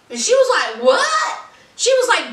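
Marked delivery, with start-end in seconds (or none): 0.8-1.4 very high, rising what; mock-stunned, voice shooting up; shocked disbelief. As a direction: squeal it as a stunned question. Acting Eve's shock at rejection.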